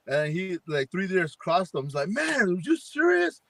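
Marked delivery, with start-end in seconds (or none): 2.1-3.4 imitating an irritated friend, pitched much higher; pissed off. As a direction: friend griping about deer crossing, hammed-up irritated. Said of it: "I'm pissed".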